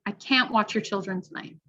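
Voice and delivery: CAN'T held clear with a full "a" vowel; stress on CAN'T. Shown as a demonstration. Teacher reading a listening test item, stressed CAN'T. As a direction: stress can't before watch. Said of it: "or am I stressing it and saying can't".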